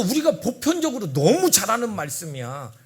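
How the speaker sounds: normal talking voice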